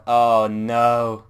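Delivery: loud, sudden oh no; dismayed exclamation. Real, not acted. Game master groaning at the dice result, loud. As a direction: cry out at a bad roll.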